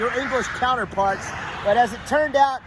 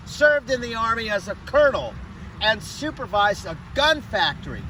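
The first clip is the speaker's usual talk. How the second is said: ordinary talk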